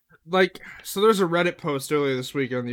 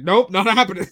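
higher, louder curt refusal; flat refusal; 5 semitones higher, 8 dB louder than usual. Retold or imitated. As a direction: shut it down flatly. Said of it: "He's like".

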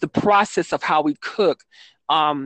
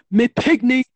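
higher, louder Jamaican patois voice mimicking the ex; imitating ex's Jamaican patois; 8 semitones higher, 3 dB louder than usual. Mimicking her Jamaican ex on culture, in higher, louder Jamaican patois. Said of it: "I hope it's not a good impression of him".

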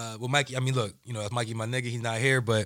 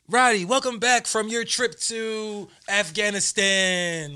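mock announcer welcome; 9 semitones higher, 4 dB louder, slower than usual. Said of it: "I'm like".

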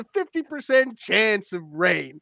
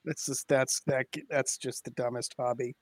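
low, soft muttered dismissal; low dismissive remark; 6 semitones lower, 8 dB quieter than usual. Real, not acted. Co-host belittling storm chasing, low and dismissive. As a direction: drop it low and quiet. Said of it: "No, I said that's the dumbest hobby".

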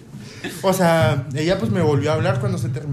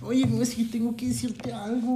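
shy confession; 5 semitones higher, 7 dB quieter than usual. Retold or imitated. Imitando su yo nervioso.

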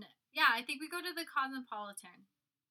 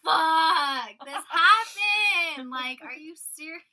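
high, loud wail of horror; horrified cry; 6 semitones higher, 17 dB louder, much slower than usual. Retold or imitated. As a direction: shriek it out as the memory hits. Realizing in horror.

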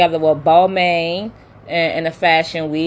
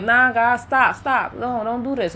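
raised pitch, urgent pleading; high pleading; 6 semitones higher than usual. Retold or imitated. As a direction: beg them to stop, pitched up.

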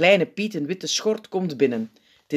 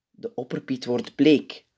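ordinary delivery

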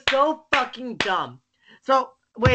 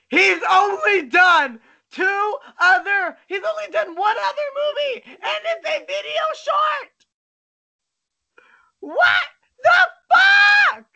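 furious shouting; 9 semitones higher than usual. Real, not acted. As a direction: yell it in disbelief. Furious over director's tiny filmography.

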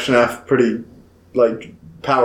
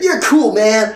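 much higher, put-on voice; mock chummy; 10 semitones higher, slower than usual. Spirit reassuring the teenage kid, mock chummy. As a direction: pitch it way up, playfully. Acted kindly spirit to teen.

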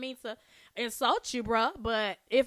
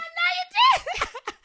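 shrieking laugh; loud laugh shout; 9 dB louder than usual. Real, not acted. Bursts out laughing at Mo three.

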